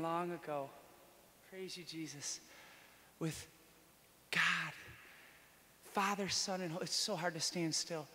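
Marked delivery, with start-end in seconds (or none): none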